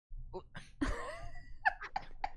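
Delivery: squealing laugh, way up high; real squeal of laughter; high-pitched laugh. Real, not acted. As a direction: burst into a high giggle. Laughing at the parasols.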